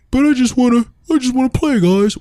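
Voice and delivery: very high, pleading whine; whiny cartoon character. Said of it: "cartoon character that gets shit on".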